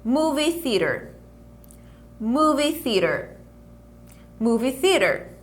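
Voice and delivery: steady pronunciation drill; repeat after me. Shown as a demonstration. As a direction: clear drill repetitions for the class.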